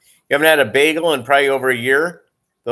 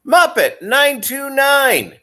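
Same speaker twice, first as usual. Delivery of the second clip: drawn-out, higher, louder name call; slow showman roll call of the name; announcer call-out; 4 dB louder, slower than usual. Acted. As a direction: announce the username slowly like a host. Announcing a viewer's username.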